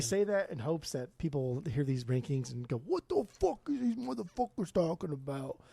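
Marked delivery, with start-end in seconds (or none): 2.8-5.6 put-on loose Boston accent, pitched up; rough Boston tough-guy voice. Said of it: "That's my Boston accent again".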